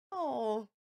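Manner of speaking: ordinary delivery, nothing marked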